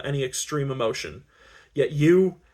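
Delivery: ordinary delivery, nothing marked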